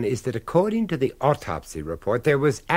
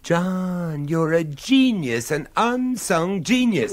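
higher, slowed, drawn-out praise; delighted praise; 7 semitones higher, 4 dB louder, slower than usual. Acted. Thrilled by the clue, he praises John slowly. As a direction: savour every word of the compliment.

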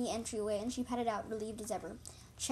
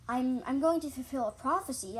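louder, stumbling amazement; amazed; 2 semitones higher, 7 dB louder than usual. A narrator's reading. Apprentice cat learning of a prophecy, amazed. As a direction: exclaim it in read-aloud wonder. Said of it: "I meowed in amazement".

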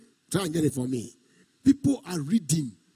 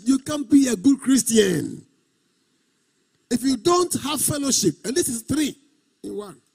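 raised, insistent preacher's delivery; emphatic preaching; 5 semitones higher than usual. Real, not acted. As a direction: declare it to the congregation.

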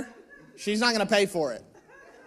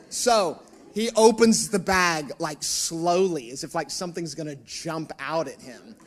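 normal talking voice

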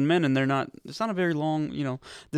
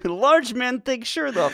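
put-on high, slow Russian accent; broken English like a Soviet comrade; 9 semitones higher, 4 dB louder, slower than usual.

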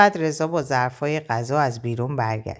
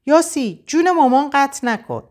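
much higher and louder call; shouting; 11 semitones higher, 4 dB louder than usual. A narrator's reading. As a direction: shout her name over the phone. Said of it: "مژگان داد زد و گفت".

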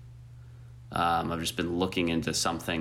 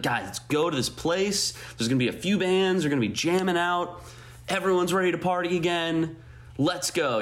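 excited; 9 semitones higher than usual. Retold or imitated. Imitating self rallying friends.

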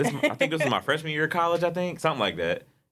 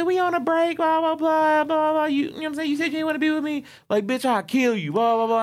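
very high, louder mock bickering; heated quarrel; 3 dB louder than usual. Couple fighting over whether they broke up, heated quarrel. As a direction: act out a couple arguing over each other.

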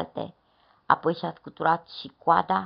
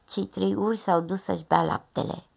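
plain reading voice

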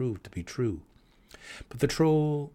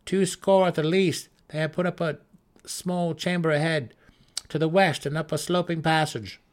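grim scout's report; 7 semitones higher, 5 dB louder than usual. Acted. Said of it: "the Svirfneblin scout replied".

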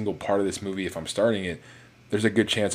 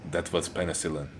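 lightly voiced scientist quote; a hint of a character voice, understated; Stanley Tucci's character; 4 dB quieter than usual. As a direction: quote it in a slightly altered voice. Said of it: "Stanley Tucci's character goes".

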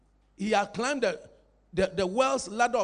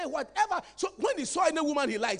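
ordinary delivery

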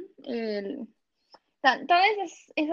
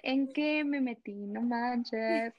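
softer, slow dismay; dismayed mutter; 6 dB quieter, much slower than usual. Retold or imitated. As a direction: lament it to yourself. Retold dismay at culture shock. (lamento en voz baja y lenta)

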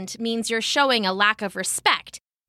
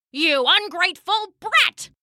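put-on high, venomous insult from an old crone; spitting anger; 9 semitones higher than usual. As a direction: spit the words out in contempt. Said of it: "the old woman spat".

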